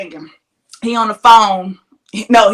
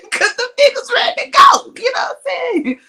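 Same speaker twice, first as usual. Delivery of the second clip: laughing. Real, not acted. Laughing through her own story.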